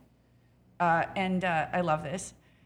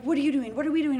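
higher, louder imitation of panicked callers; frantic colleagues demanding answers, played up; mock panic; 6 semitones higher, 5 dB louder, faster than usual. Panicked restaurateurs calling her.

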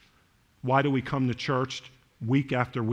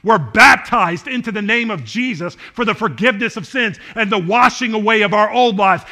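normal talking voice

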